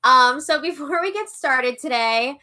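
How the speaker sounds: ordinary talk, nothing marked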